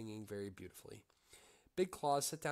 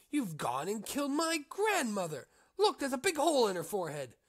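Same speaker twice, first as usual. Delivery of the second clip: outraged accusation; 12 semitones higher, 10 dB louder than usual. Acted. Accusing the innkeeper of killing.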